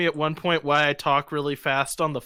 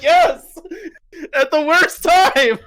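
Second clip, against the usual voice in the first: mock exclamation; 10 semitones higher, 7 dB louder, slower than usual. Retold reaction to proctor's slip.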